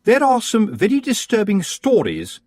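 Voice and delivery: high, sugary-polite rival witch; sweet on top, a knife underneath; Missus Earwig. Acted.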